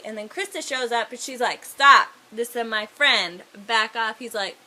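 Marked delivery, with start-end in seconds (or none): 1.6-4.2 mock stern command. Fairy defends her friend.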